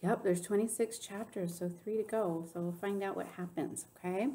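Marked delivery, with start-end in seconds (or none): none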